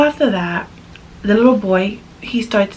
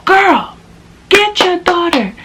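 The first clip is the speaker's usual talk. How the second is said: sassy call-out; 9 semitones higher, slower than usual. Real, not acted. Calling out to parents.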